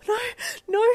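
high, gasping panicked squeal; petrified, breathless cry; internal scream. Acted. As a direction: gasp it out in terror. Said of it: "you screamed internally".

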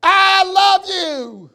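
shouted I love you, drawn out; loving shout. Shown as a demonstration. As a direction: call it out slowly across the canyon. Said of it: "by saying".

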